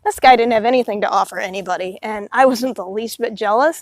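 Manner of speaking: usual speaking voice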